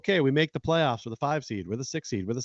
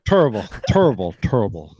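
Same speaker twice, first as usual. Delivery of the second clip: Charles Barkley impression; 4 semitones lower, 8 dB louder than usual. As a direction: ham up the Barkley catchphrase. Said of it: "Sound like Charles Barkley over there".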